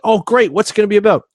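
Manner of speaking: high-pitched, eager question; retold eagerness. Invited guests ask about the event, retold eagerness.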